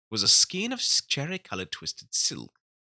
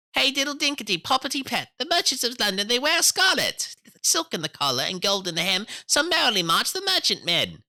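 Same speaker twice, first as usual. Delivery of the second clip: high, loud sing-song mouse voice; 6 dB louder than usual. A narrator's reading. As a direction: chant it brightly like tiny mice. Mice sing on.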